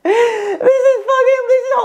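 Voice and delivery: high, squeaky words through laughter; laughing helplessly. Real, not acted. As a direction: squeeze the words out while cracking up. Helpless laughter at own haircut.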